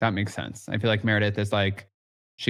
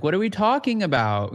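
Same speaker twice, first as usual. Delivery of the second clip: mock innocent; 8 semitones higher than usual. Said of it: "be like".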